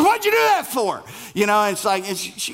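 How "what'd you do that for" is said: startled and annoyed, pitched much higher; irritated outburst. Retold or imitated. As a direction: blurt it out crossly right after a scare. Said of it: "Sometimes it makes you irritated".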